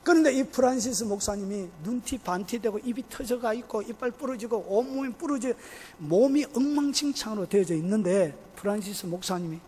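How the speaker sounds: ordinary delivery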